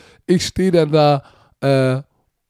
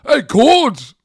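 higher, louder call of recognition; excited mock shout; 7 semitones higher, 4 dB louder than usual. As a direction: shout "Ey, Coach!" across the platform. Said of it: "dreht sich um".

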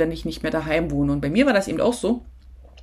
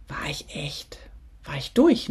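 whispered admission. Real, not acted. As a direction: drop to a whisper on "war ich durch". Admitting she was worn out. (erschöpft geflüstert)

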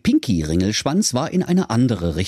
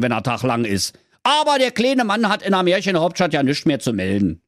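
higher-pitched, griping Berlin voice; grumbling huntsman; 6 semitones higher, faster than usual. A narrator's reading. Huntsman explains orders from the Brothers Grimm, grumbling voice. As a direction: rattle off the complaint in Berlin dialect. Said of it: "Der Jägermeister Jägermeister antwortete".

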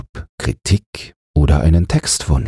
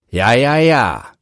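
calling out; 7 semitones higher than usual. Acted. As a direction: cry it out as the tailor. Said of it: "rief Meister Böck".

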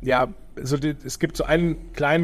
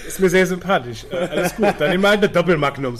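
laughing; 5 semitones higher, 5 dB louder than usual. Real, not acted. Joking about magnum bottles.

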